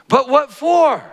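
high, loud bewildered cry; startled. Acted. Shasta questioning the lion, high and startled. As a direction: demand an answer, shocked.

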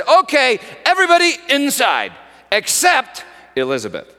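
loud, driving storytelling preacher; full-force preaching. Acted. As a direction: belt the story out to the congregation.

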